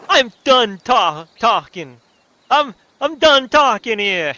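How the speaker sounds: very loud, high, drawn-out Brooklyn bellow; exaggerated Brooklyn shout; Brooklyn accent. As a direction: storm off in a hammed-up Brooklyn accent. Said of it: "Gave him the Brooklyn accent".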